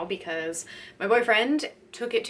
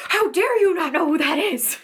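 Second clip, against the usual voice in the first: high, loud mock outrage; hammed-up indignation at a clueless fan; mock irrational rage; 8 semitones higher, 6 dB louder than usual. Wanting to scold the fan, mock outraged. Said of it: "irrational rage".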